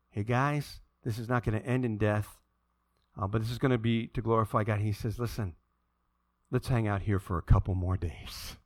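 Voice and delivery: low, soft, easygoing reassurance; unhurried calm. Acted. Jesus tells the guys to wait, calm and low. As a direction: speak low and relaxed, in no rush at all.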